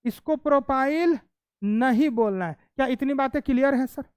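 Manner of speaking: normal talking voice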